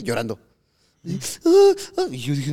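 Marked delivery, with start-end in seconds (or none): 1.5-2.1 high wailing cry, much higher; high mock sob of a scared girlfriend; imitated cry. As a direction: mimic a panicked crying wail. Mimics girlfriend crying out.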